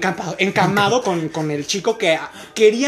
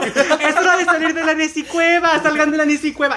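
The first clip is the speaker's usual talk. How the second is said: mock yelling; 6 semitones higher than usual. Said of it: "yo estoy gritándole".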